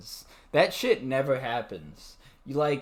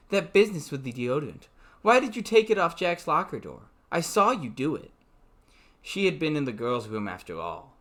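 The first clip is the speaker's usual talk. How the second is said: even novel reading voice; story narration; 4 semitones lower, 4 dB quieter than usual. A narrator's reading. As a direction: read the book aloud, steady. Reads novel aloud.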